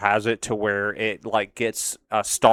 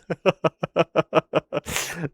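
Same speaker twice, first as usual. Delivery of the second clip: high giggling laugh; 6 semitones higher than usual. Real, not acted. Laughing after the joke.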